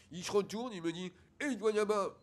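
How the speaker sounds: put-on mushy consonants, childish explaining; speech defect. Son explaining the school trouble to his father, lisped. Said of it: "il parle comme ça".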